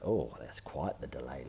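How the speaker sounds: usual speaking voice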